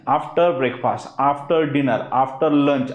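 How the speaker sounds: "After breakfast" stretched out very slowly; slow model phrase. Shown as a demonstration. Teacher models the answer for students, very slowly. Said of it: "काहीच article येणार नाही".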